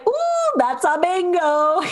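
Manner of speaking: high, slow gleeful imitation of Aldo; Aldo's bingo line. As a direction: savor the line like a gleeful villain. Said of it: "and he's like".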